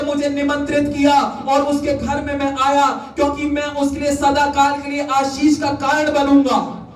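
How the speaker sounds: high, sustained proclaiming voice; preaching. Real, not acted. Preacher promises blessing to the household, high and ringing.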